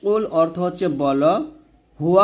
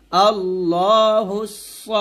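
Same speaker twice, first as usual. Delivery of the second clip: slow recitation; 2 semitones higher, much slower than usual. Shown as a demonstration. Model recitation drill.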